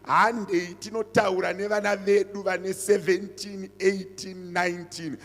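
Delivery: loud preaching, rising and insistent; preaching at full force. Real, not acted. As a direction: drive the warning home to the parents. Pastor warns parents from pulpit.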